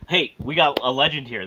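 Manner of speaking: ordinary talk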